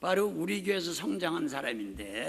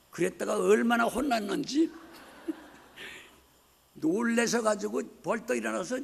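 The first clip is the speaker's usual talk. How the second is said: laughing; 3 semitones higher than usual. Real, not acted. Laughing while retelling.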